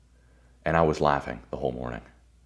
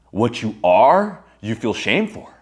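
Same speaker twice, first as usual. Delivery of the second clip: ordinary talk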